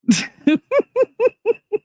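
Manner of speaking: high-pitched trailing laugh; high burst of giggles, petering out. Real, not acted. Streamer cracking up at her carpenter joke, laughing.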